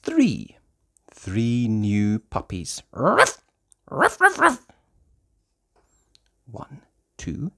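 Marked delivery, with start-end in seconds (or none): 2.9-4.7 high yappy little barks; puppy bark. Acted. Reader voicing new puppies, high yaps.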